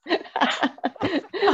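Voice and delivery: a real, high laugh that bubbles up; bright burst of laughter. Real, not acted. Laughing at her own travel plans.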